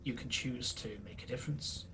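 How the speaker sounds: low, very soft, measured voice; hushed parting counsel, fading almost to a whisper; solemn mentor figure. Acted. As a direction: speak quietly with long, weighty pauses. Game master plays a figure releasing someone.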